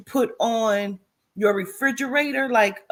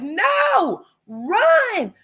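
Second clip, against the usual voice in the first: much higher, loud urgent cry; urgent call; 10 semitones higher, 4 dB louder than usual. Retold or imitated. Warning her sister to run, high urgent cry. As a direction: shout a warning at them to flee.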